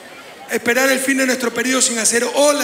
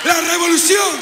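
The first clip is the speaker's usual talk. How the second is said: ranting dictator speech; 4 semitones higher than usual. Real, not acted. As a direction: build to a roaring campaign climax. Rally speech climax, rising to shouts.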